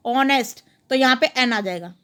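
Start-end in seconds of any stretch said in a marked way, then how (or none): 0.1-0.6 ऑनेस्ट said ɔnest, silent h, opening on the o; silent h honest. Shown as a demonstration. Teacher repeating honest so students hear the silent h. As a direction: drop the h in ऑनेस्ट and start on o. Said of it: "एच silent हो जाता है और ओ की आवाज़ देता है".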